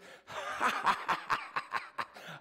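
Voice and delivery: high, loud unvoiced laugh; breathy excited laugh. [name] laughs at the pastor.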